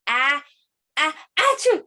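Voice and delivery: hammed-up pretend sneeze; fake sneeze. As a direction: sneeze wind-up into a high loud achoo. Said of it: "let's sneeze into our elbow".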